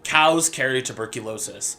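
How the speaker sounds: ordinary talk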